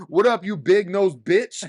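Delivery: loud, high, hammed-up drunken yell; imitating a drunk friend's loud greeting; rowdy drunk shout. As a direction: bellow it like a wasted buddy crashing the party. Drunk buddy's rowdy entrance.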